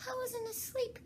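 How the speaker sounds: very high, thin sleepy squeak; a sleepy mouse protesting; hoarse feeble Dormouse. Acted. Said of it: "in a hoarse, feeble voice".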